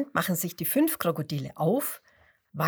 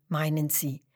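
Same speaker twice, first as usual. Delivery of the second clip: plain reading voice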